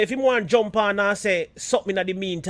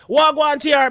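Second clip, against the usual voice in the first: friendly greeting call; 6 semitones higher, 6 dB louder than usual. Retold or imitated. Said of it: "a brethren say".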